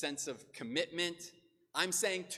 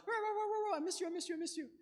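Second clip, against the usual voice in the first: much higher yappy dog voice, rah rah; excited dog voice; much faster than usual. Retold or imitated. The dog greeting the returning vet, high excited yapping. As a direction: yap like an overjoyed dog greeting its owner. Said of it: "the dog is like".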